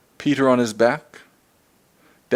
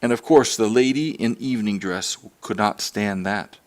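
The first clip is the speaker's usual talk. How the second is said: ordinary delivery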